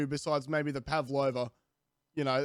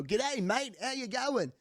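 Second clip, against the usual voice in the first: higher put-on Australian waiter greeting; mock Aussie waiter; 9 semitones higher than usual. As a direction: ham up an Australian server's welcome. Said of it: "the wait staff probably go".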